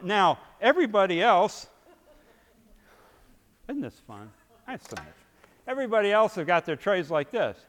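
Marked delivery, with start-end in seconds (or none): none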